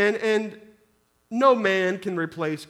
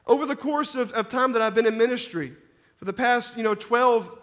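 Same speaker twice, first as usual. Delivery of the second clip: ordinary talk, nothing marked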